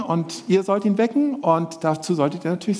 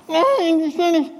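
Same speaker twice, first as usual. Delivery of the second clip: put-on high childish puppet voice refusing; a stubborn little bird puppet, pitched high; puppet Willi; 11 semitones higher than usual. Presenter voicing puppet Willi to children, high and stubborn.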